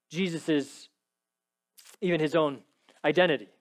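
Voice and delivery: ordinary talk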